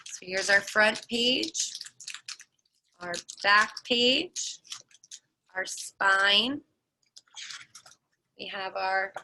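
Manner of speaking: ordinary delivery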